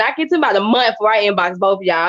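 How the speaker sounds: ordinary talk, nothing marked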